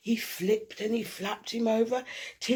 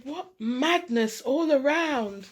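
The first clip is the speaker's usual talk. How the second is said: high, loud, slow exasperated cry; 1 exasperated mum; 5 semitones higher, 6 dB louder, slower than usual. A narrator's reading. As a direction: throw up your hands at the chaos. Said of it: "Mum said".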